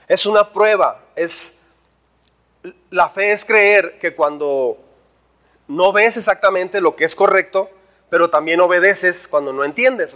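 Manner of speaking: ordinary delivery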